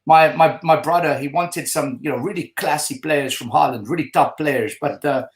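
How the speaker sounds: imitated Dutch accent, confiding an explanation. Manager's brother explaining the chairman to a fan, Dutch accent. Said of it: "That Dutch accent".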